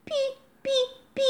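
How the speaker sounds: persistent high chick peeps; insistent peeping. A narrator's reading. Chick rolling toward the rabbit, insistent peeps. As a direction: keep peeping, pushier each time. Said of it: "Pipipkowanie stało się bardziej natarczywe".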